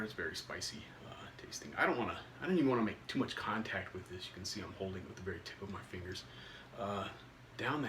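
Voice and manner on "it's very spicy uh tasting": unvoiced whisper. Real, not acted. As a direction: breathe the words out without voice. Smelling the chili chip up close.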